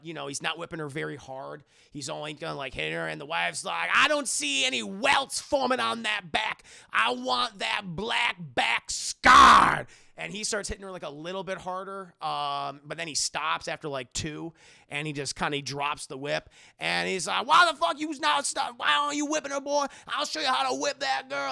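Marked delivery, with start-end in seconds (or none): 3.9-9.9 higher, much louder, slow shouted demand; cruel shout. Retold or imitated. Wife demands a harder whipping, shouting. 17.5-21.5 much higher, louder raging voice; furious yell. Retold or imitated. Master rages at Solomon for not whipping, furious.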